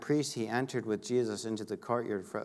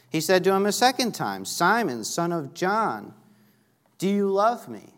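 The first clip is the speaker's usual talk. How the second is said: louder, higher scripture reading; emphatic questioning; 8 semitones higher, 6 dB louder than usual. Acted. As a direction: proclaim the question to the congregation. Second asking, read out with force.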